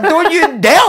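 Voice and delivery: loud, high-pitched shriek of disbelief; indignant yell. Retold or imitated. Answering the insulting song, indignant shriek. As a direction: yelp it, utterly affronted.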